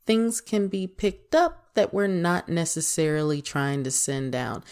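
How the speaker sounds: usual speaking voice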